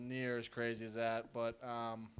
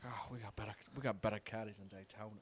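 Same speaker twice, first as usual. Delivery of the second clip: mocking Southern; 4 semitones lower, 6 dB quieter, faster than usual. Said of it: "that's not even Southern anymore".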